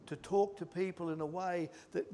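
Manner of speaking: ordinary talk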